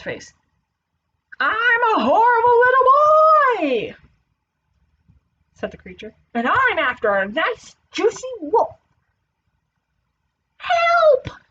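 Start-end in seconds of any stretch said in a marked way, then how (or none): 1.4-4.0 high, loud creature voice; horrible little boy. Acted. Creature boy announces himself to wolf. 6.3-8.7 high, hungry creature voice; menacing little boy. Acted. Creature boy threatens the wolf. 10.6-11.4 high, loud cry for help; terrified yell. A narrator's reading. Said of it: "he yelled".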